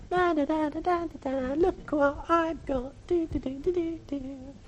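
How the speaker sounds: put-on high, soft singsong child voice; quiet, high mock singsong, showing off a prize; gloating child. Child happily parading what it got.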